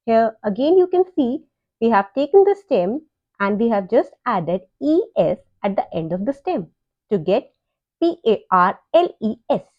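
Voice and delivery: usual speaking voice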